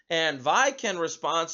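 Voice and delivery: ordinary delivery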